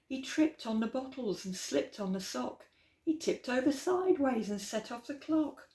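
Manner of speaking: put-on lively, rhythmic read-aloud for kids; storybook reading. A narrator's reading. Storyteller reading Slinky Malinky aloud to children, lilting and playful. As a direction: read the rhyme with bounce and relish.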